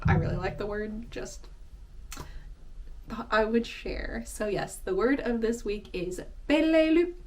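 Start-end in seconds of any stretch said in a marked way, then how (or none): none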